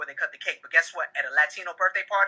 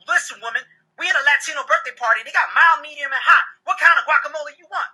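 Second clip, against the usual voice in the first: high-pitched exasperated rant; acted husband losing his patience; mad, arguing; 9 semitones higher, 3 dB louder than usual. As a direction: argue back, voice climbing higher and louder. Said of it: "I'm getting mad at her".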